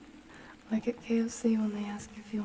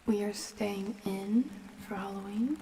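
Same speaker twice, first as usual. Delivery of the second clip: usual speaking voice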